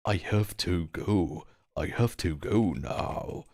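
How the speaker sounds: slow, drawn-out American drawl; mock American drawl. Streamer signing off to chat in a hammed-up American drawl. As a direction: drawl it out like a cowboy saying goodbye in an American accent. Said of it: "How do you say it?".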